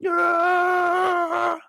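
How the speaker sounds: high mock creature roar; hammed-up cartoon monster roar. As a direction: roar like the bloater rising up. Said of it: "This thing hop out like".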